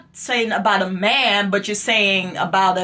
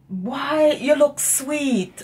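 put-on slow, higher "Boy, you look sweet" in Jamaican lilt; patois demo; 5 semitones higher, much slower than usual. Shown as a demonstration. Mother demonstrating patois to her son, slow and lilting. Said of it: "No, you said".

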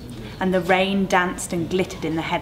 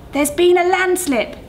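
higher, louder and slow, called across the rain; calling out; 12 semitones higher, 6 dB louder than usual. Acted. Other driver calls a warning through the rain, loud and slow. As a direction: shout the warning across the road. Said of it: "the other driver called".

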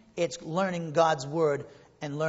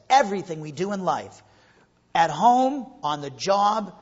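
forceful scripture reading; 2 semitones higher, 7 dB louder than usual. Real, not acted. Preacher quoting scripture with force.

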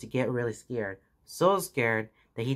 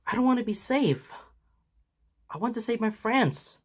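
tearful yell; 11 semitones higher than usual. A narrator's reading. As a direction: yell it near tears. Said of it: "yelled Midoriya, tears filling his eyes".

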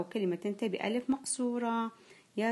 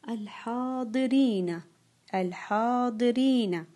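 slow dictation; 2 semitones higher, 4 dB louder, slower than usual. Shown as a demonstration. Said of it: "سوف أنطق الكلمة وأنتم تضعون دائرة".

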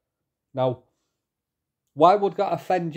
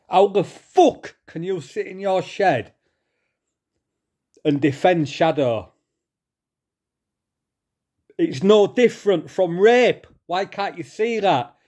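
real angry rant; 4 semitones higher than usual. Real, not acted. Vlogger confronting the man who defends Shadow, angry. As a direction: demand an answer in real anger. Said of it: "I'm so fucking angry".

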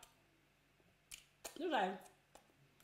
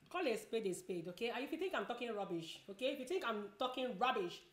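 normal talking voice

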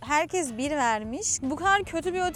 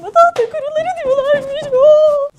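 scream; 13 semitones higher, 14 dB louder than usual. Real, not acted. Bedbugs found in hotel room.

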